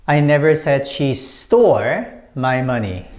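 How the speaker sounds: "stole" stretched and punched, short break before "my money"; stress on STOLE. Shown as a demonstration. Teacher showing a whole new meaning, stress on "stole". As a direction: hit the word "stole" hard and hold it. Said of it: "When you put emphasis on this one".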